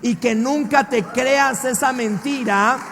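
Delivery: preacher's fervent shouted climax; preaching at full force. Acted. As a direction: proclaim it to the whole church.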